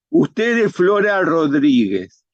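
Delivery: empleado indiscreto. Acted. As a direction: announce it drawn out, with no discretion. Empleado anuncia el nombre completo. (proclamando el nombre, lento y agudo)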